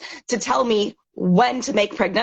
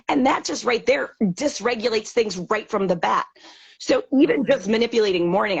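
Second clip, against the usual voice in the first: ordinary delivery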